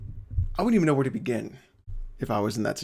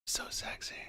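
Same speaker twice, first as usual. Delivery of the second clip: whispered, very high mock flirt; breathy mock whisper; 16 dB quieter than usual. Friend mocking a teammate's flirty whisper during a game. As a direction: whisper it like a teasing come-on. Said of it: "and he's like".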